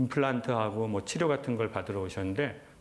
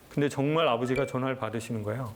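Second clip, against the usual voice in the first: normal talking voice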